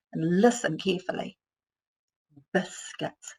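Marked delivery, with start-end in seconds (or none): 2.5-3.4 soft voiceless stretch; whispered sounding out. Shown as a demonstration. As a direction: whisper it slowly. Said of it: "say it slowly".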